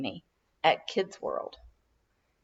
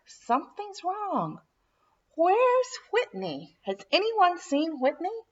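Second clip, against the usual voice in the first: alarmed father; 10 semitones higher than usual. A narrator's reading. Dad counting heads on the beach, growing alarmed. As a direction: realise a child is missing. Dad realising child missing.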